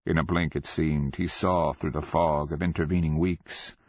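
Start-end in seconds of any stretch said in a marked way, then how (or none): none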